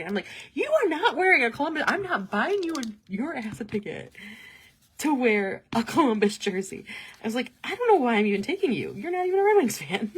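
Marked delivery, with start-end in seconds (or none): 0.5-4.1 high, loud mock outrage; mock indignant scolding. Girlfriend jokingly forbidding boyfriend the rival team's jersey, mock indignant. 5.0-10.1 high, loud mock outrage; mock indignant scolding. Girlfriend jokingly forbidding boyfriend the rival team's jersey, mock indignant.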